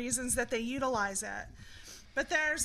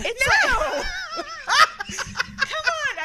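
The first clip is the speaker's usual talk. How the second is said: laughing protest; 13 semitones higher, 10 dB louder, much slower than usual. Real, not acted. Laughing denial, protesting loudly.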